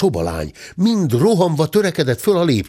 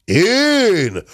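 high, drawn-out outburst; indignant yell; 9 semitones higher than usual. A narrator's reading. Accused gentleman roaring back at the maid, indignant yell. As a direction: yelp it in outraged disbelief. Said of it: "ordított föl a ténsúr".

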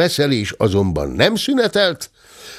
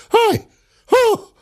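short, very high yelps; high-pitched cries of surprise; cry. A narrator's reading. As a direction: yelp out high as if struck. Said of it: "Ez a kiáltás".

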